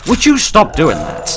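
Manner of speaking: a real fed-up yell at an enemy; exasperated yell. Real, not acted. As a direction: shout it at the pest in the game. Enemy keeps attacking him.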